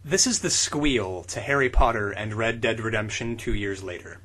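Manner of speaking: ordinary talk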